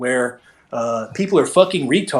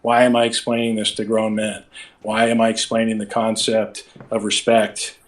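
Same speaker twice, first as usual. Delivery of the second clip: flat monotone; 4 semitones lower than usual. Shown as a demonstration. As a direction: drone it out with no feeling. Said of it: "boring and confusing if I was just like".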